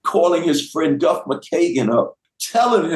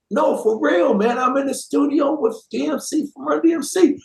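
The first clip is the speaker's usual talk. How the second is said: higher-pitched, imitated earnest plea; mock sad sincerity; 8 semitones higher than usual. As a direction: plead as the friend turned sad, pitched up. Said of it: "he gets real sad and he goes".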